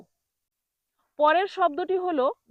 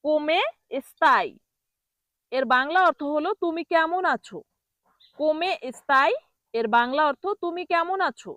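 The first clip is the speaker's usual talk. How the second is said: ordinary talk, nothing marked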